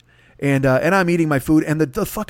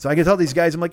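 usual speaking voice

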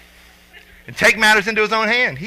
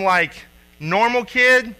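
ordinary talk, nothing marked

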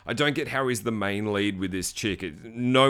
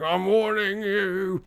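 slow zombie voice; 9 semitones higher, much slower than usual. Retold or imitated. Zombie threatening hunter.

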